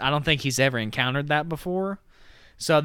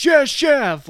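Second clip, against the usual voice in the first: much higher, louder sharp yell of obedience; staff shout; 11 semitones higher, 6 dB louder than usual. Retold or imitated. Kitchen staff snapping back to their chef, loud and high. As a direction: bark it like a line cook answering. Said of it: "it's always".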